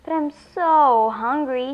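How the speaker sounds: slow whine with a drawn-out "so"; whining. A narrator's reading. Hungry alien begs for the pumpkin, whining. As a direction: whine and stretch it out. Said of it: "he whines".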